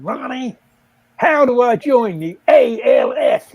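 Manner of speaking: loud, drawn-out American stockbroker. Retold or imitated. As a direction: imitate someone oblivious booming across a restaurant. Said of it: "he said in a very l-, loud voice".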